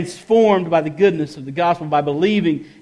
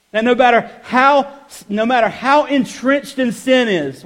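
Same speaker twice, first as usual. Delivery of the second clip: higher, louder pulpit proclamation; preaching at full force; 7 semitones higher, 6 dB louder than usual. Acted. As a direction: drive the gospel home to the congregation.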